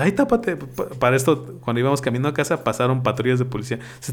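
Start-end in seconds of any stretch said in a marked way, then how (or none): 0.0-0.6 scared aunt. Retold or imitated. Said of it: "bien espantada dice".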